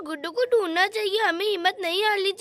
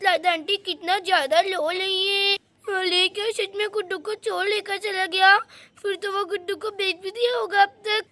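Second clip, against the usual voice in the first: ordinary delivery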